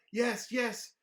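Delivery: put-on mild frightened kid voice; kid confessing. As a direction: blurt out the truth scared. David confesses scared.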